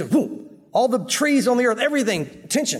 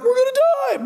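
panicked fishermen. Retold or imitated. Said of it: "they're like".

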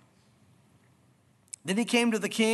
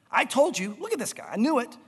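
high, indignant I-told-you-so; vindicated complaint; 3 semitones higher than usual. Acted. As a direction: complain to an ally, proven right. King complains to Jehoshaphat, acted.

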